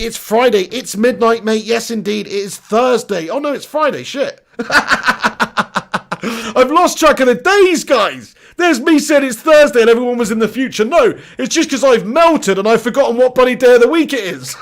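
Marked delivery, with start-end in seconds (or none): 4.6-14.6 loud, half-laughing protest; laughing exasperation. Real, not acted. Streamer admitting he mixed up the day, laughing exasperation. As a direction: exclaim loudly through laughter at your own blunder.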